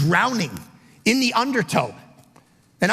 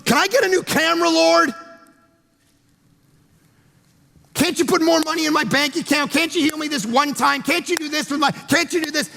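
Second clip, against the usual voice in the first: higher, whiny mock prayer; mock entitled plea; 6 semitones higher than usual. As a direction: parody a demanding believer. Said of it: "we just whistle our way through life and go".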